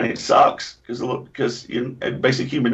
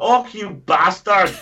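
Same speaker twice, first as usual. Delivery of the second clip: sudden high, loud, slow Glasgow Scots cry; Glaswegian outburst; 11 semitones higher, 4 dB louder, slower than usual. Retold or imitated. Drunk friend finally cracks into her Glasgow Scots accent. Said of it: "She couldn't not speak with a Scots accent".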